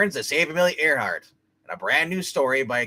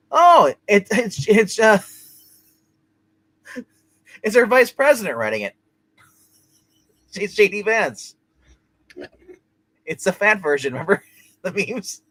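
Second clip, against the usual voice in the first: surprised laugh; 8 semitones higher, 5 dB louder than usual. Real, not acted.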